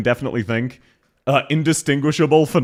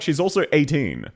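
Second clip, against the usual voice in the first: ordinary delivery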